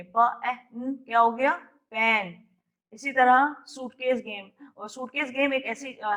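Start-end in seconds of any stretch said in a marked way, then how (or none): 0.0-2.4 प, ए, न said one by one, then joined into पेन; teacher sounding out a word for kids; phoneme blending demo. Shown as a demonstration. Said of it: "इसकी blending करेंगे".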